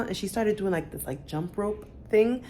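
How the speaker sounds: ordinary delivery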